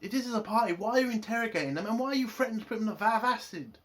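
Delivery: high, loud, fast exasperated outburst; ranting in outrage over the trial being called a party; indignant rant. Retold or imitated. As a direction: blow up in disbelief at the hypocrisy. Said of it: "He's like".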